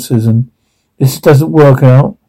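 ordinary delivery

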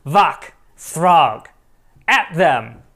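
loud monster command, word by word; booming monster. Acted. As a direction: order the wolves to attack.

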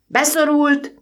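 high, loud alarmed shout; boy shouting up to the engine driver. A narrator's reading. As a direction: yell it out urgently. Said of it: "kiáltotta".

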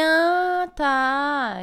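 high, loud 'ah, tá' of sudden insight; a hammed-up lightbulb moment; exaggerated aha, half-mocking herself; mock sudden realization.

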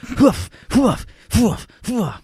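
high, breathy hoof hoof huffs; mock fight huffing, high and sharp; imitating Hulk's huffing. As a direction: puff out the huffs like a wrestler mid-fight. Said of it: "He was, like, huffing".

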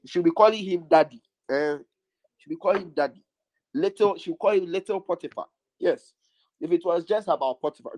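ordinary delivery

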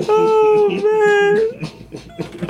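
squealing oh man; high excited cry. Real, not acted. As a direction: yelp it out high. Reacting to game.

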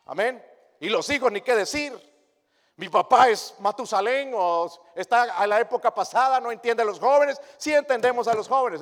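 loud, high preaching cry; fiery preaching. Acted. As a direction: press the point home to the flock. Preaching at full force. (predicación a todo pulmón)

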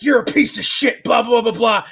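imitating a furious actor; mock angry yell. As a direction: yell it at him like an angry dad, half in jest. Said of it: "and he's like".

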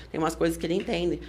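normal talking voice

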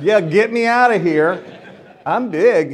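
loud plea for help. Retold or imitated. Fallen off stage, asking for help.

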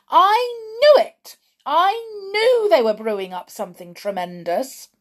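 loud high triumphant shout. A narrator's reading. Grandmother leaping from her chair, loud shout. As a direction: leap up and shout it out.

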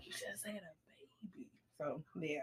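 whispered gossip aside. Real, not acted. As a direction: lean in and whisper it.

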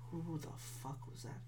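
barely audible question to himself; baffled whisper. Real, not acted. Podcaster working out who yelled, very hushed mutter. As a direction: whisper it, lost in thought.

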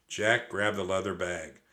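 usual speaking voice

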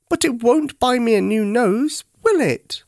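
high, plaintive teddy voice; a little bear telling his sad tale; Teddy explaining. A narrator's reading. Teddy explaining to Monkey about the money, high and plaintive. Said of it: "said Teddy No-Nose".

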